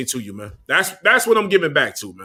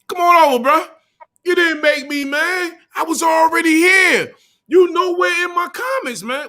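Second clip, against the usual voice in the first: shouted taunts; 13 semitones higher than usual. Real, not acted. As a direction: yell him down, defiant.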